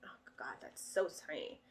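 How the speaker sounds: hushed whispered aside. Real, not acted. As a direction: whisper it in surprise. Tiny game pieces.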